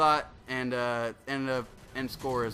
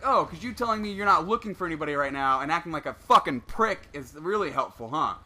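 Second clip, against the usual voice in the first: retold sarcastic tirade, raised pitch; losing his shit; 3 semitones higher, 5 dB louder than usual. Gamer retelling his telling-off of an unhelpful manager, angrily. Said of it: "lost my shit on him".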